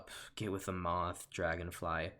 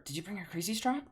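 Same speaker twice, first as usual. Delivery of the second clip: much higher, put-on mosquito voice; alternative voice; 11 semitones higher, faster than usual. Shown as a demonstration. Mosquito greeting his friends, second try. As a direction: squeak the question in a new character voice.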